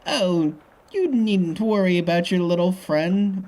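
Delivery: put-on old lady character. Voicing old Missus Bigsley.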